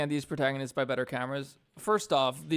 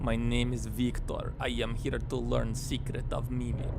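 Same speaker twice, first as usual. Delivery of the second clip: slow, bad Russian accent; heavy Russian accent, slowly; slower than usual. Shown as a demonstration. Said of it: "It's kind of a bad Russian accent".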